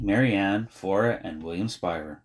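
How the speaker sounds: plain reading voice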